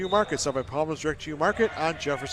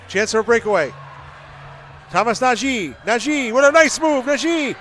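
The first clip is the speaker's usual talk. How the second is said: rising, louder play-by-play on a breakaway; building excitement; 7 semitones higher, 4 dB louder than usual. Real, not acted. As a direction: build the call as the chance develops. Breakaway building toward goal.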